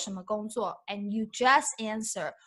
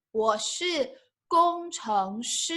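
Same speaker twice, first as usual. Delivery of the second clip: slow model phrase; slower than usual. Shown as a demonstration. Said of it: "teacher models the phrase slowly".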